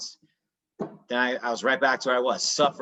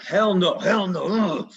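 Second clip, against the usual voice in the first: higher, louder defiant "Hell no" twice; defiant refusal; 7 semitones higher, 5 dB louder, slower than usual. Retold or imitated. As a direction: snap back as if someone wants to take it from you. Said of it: "you just jump up and you're like".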